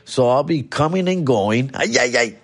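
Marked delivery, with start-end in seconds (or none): none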